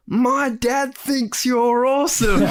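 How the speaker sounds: put-on high, slow, laughing child call; child's yell. Random child yelling to the postman, high laughing call. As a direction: shout it like a kid from the playground.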